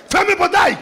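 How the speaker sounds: high, loud hammed-up command; furious husband shouting at the household; mock angry order. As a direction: bark it like an enraged husband. Angry man orders gate shut.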